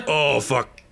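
hooked man forcing a cheerful greeting; wounded man in pain. Acted. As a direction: gasp it out through the pain. Dying man gasps in pain.

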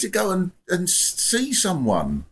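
impression of radio host. Mimicking the host's advice to a caller, slow mock verdict. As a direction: draw out the host's verdict, imitated. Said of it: "Good impression".

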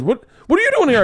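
high, fast, stumbling surprise; astonished question. Retold or imitated. Schoolmate finds him in the apartment, high quick astonishment. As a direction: act out an amazed old friend.

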